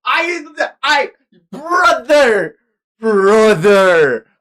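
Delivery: a real wail of shock at the reveal; real anguished shout. Real, not acted. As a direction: cry out in shocked disbelief. Shocked reaction to game reveal.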